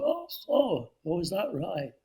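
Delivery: a mild, surprised Hockney "oh"; David Hockney impression. Hockney hears about Stonewall, a light mock-surprised impression. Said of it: "that's a great impersonation".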